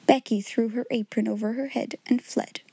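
ordinary delivery

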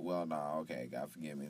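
ordinary talk

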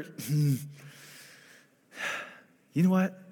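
ordinary talk, nothing marked

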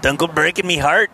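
a light Irish lilt on "Me Heart", pitch up a bit; hint of an Irish brogue, playful; Irish accent. Joking about the beer name, light Irish accent. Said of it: "That was my Irish accent".

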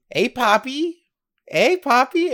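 high, lilting call rising at the end; imitated come-on. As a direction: play a stranger calling out to catch his attention. Stranger calls out to the buddy.

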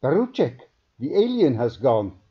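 high, loud alarmed call; panicked alarm. A narrator's reading. Scientist calling his colleague in panic, loud and high. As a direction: shout the alarm to your colleague. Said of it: "Panic flitted across his weary green eyes".

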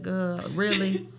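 low, slow, unimpressed reaction; skeptical friend. Acted. Friend reacting to her story, skeptical. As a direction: drag it out, low and doubtful. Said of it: "she gonna be like".

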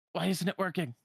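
high, frustrated question; frustrated complaint. Acted. Donald's magic fails on him, high frustrated voice. Donald's spells all fail.

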